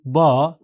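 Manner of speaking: बा said slowly with a rising tone. Shown as a demonstration. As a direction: let बा climb at the end. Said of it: "चढ़ती तान, rising tone".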